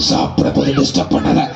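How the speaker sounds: rough lion voice; snarling beast; low lion growl. Acted. Lion tells the goat not to disturb its meal.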